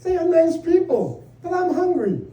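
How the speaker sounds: husband placating wife before the punchline; humoring. Retold or imitated. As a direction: humor her then deliver the jab.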